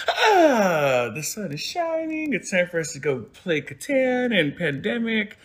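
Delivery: high, slow sing-song husband voice; lightly voiced chipper husband; mock-cheerful morning greeting; cheery husband.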